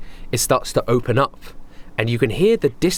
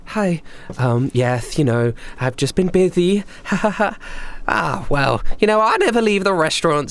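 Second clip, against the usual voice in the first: higher, forced polite cheer; awkward Heather; 8 semitones higher than usual. Acted. Heather greets old acquaintance, high forced cheer. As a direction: fake friendliness with a nervous laugh. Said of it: "Heather's like".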